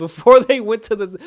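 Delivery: amused outburst mid-sentence; laughing. Real, not acted. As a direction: laugh in disbelief while talking. Amused disbelief at the parents.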